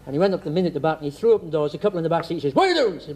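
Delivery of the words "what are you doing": high-pitched policeman's demand; acted challenge. Policeman catching a couple in a car, comic challenge. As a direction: pounce on the question, pitched well up.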